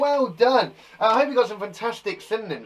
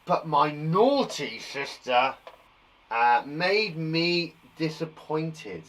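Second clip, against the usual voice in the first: very slow careful reading; slow reading aloud; 2 semitones lower, much slower than usual. Shown as a demonstration. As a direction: drag each word out slowly. Reading child's sentence.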